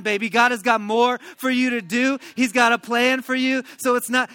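loud, high preacher's call; fired-up preaching. Acted. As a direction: preach it out to the whole church. Preaching at full force.

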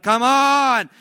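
loud, high impatient groan of Come on; hammed-up impatience in the pew; impatient churchgoer. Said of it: "sitting there like".

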